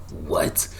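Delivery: ordinary delivery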